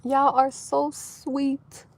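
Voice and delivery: moved almost to tears, speaking gently; quietly welling up with joy; tearing up. Real, not acted. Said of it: "Yes, I'm tearing up".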